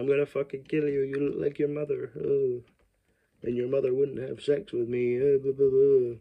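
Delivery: a hint of a high mock psycho; lightly voiced ranting killer, fast at first; hammed-up killer voice. Killer taunting the girl, lightly voiced mock rant. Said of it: "it's really funny to me to make him sound like that".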